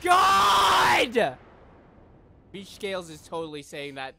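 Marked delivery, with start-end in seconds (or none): 0.0-1.4 very slow, swelling exclamation; awestruck cry. Real, not acted. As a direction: stretch it out into a shout. Awed by the animation.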